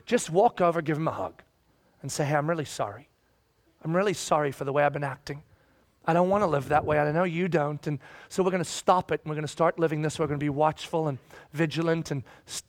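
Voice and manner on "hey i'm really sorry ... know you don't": low, gentle apology; sincere apology. Acted. Church member making up after a hug, sincere apology. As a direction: say sorry gently and earnestly.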